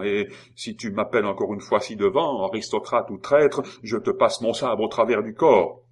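loud, hard threat building to the end; threatening. Acted. Lorrain threatening Simon with his sabre, loud and menacing. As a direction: warn him you'll run him through.

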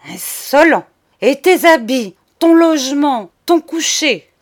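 loud, high, angry retorts in short bursts; colère indignée. Acted. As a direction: fire back each item in outrage. Cousin rages at Charles. (reproches furieux, forts et aigus)

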